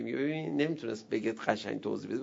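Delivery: usual speaking voice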